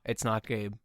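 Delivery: low, disembodied inner voice; voice of consciousness. Pretend. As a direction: speak low as his inner voice. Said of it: "it's the voice of your consciousness".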